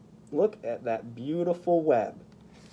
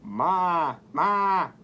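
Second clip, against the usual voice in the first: louder bleating ma; goat bleat; 4 semitones higher, 5 dB louder than usual. Acted. Voicing the goat for the children, loud bleat. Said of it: "said the goat".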